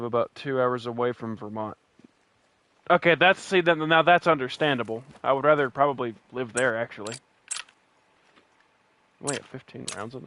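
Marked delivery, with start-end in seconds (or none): none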